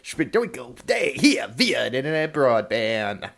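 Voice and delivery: loud, low announcer welcome; big low-pitched on-air introduction; radio show host intro. Acted.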